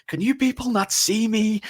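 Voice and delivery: high, slow put-upon whine; mock Chandler. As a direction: squeak it out, ignored and offended. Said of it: "Chandler goes".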